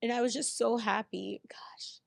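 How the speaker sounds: real tears, whispering through them; choked up and barely voicing it; tearful whisper. Real, not acted. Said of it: "I'm you today".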